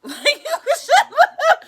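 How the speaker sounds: high, slow words through laughter; laughing. Real, not acted. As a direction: crack up while saying it. Laughing at her own exclamation.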